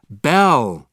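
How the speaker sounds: loud call of a name. A narrator's reading. Father to his daughter Belle, calling out loudly. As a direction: call out to someone across the castle. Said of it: "he calls".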